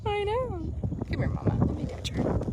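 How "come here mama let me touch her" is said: soft coaxing to a horse; soothing. Real, not acted. Owner softly coaxing her frightened mare closer. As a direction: hush her gently, almost breathless.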